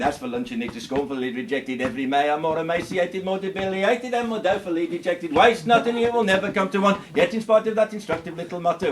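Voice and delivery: fast tongue-twister patter, barely breathing; tricky fast patter. Acted. Comedian showing off the tricky fast verse. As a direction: rattle off the wordy verse at speed. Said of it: "Now the tricky bits".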